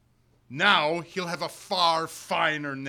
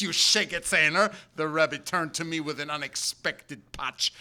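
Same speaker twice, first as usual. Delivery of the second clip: usual speaking voice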